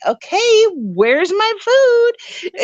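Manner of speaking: high, drawn-out voice of an expectant pig; a pet pig asking to be fed, slow and high; hungry pig. Acted. Pig poking his snout in for food, high demanding voice. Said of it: "stick his snout in there like".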